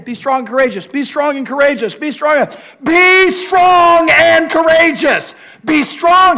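from loud to a high roar; building command. Acted. Preacher escalating God's charge at the congregation. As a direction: build each repeat to a shout.

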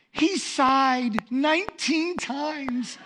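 high, very slow mock confession; distressed wife. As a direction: imitate a wife counting out an embarrassment. Distressed wife reporting the sighs.

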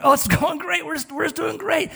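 high, falsely upbeat reassurance; mock-breezy cover-up voice; put-on cheerfulness. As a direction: pretend it is all going fine. Keeping up a false front.

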